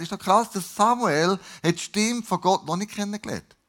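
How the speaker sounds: ordinary talk, nothing marked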